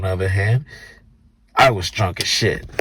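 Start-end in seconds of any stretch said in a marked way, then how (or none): none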